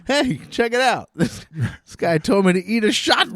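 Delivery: high, laughing show-off; gleeful excitement. Retold or imitated. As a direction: brag about it with a laugh. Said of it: "I was literally like".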